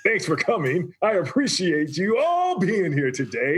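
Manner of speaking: high, stretched-out radio host thanks. Shown as a demonstration. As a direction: ham it up like a radio host welcoming guests. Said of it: "Trav got a radio voice".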